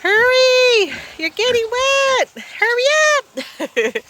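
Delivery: very high, urgent sing-song; high pet voice. Acted. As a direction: hurry the animal along in squeaks. Urging animal out of rain.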